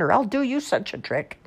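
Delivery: high, mildly cried threat; crying out. A narrator's reading. Old man threatens the ghoul, mild high cry. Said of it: "cried the old man".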